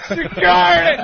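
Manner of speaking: high mock cry for help; mock alarm call. Host reliving guest reaching into bag, mock alarm. Joking cry for security guards.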